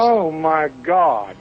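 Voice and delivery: very slow, drawn-out disbelief; playfully scandalised groan; mock dismay. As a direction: drag each word out as if appalled. Pretend shock at naked videos joke.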